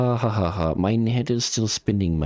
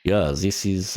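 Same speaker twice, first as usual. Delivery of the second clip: usual speaking voice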